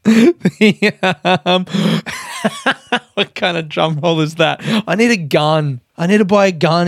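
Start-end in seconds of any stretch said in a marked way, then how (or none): 1.7-3.1 high laugh. Real, not acted. Keeps laughing at the drum roll.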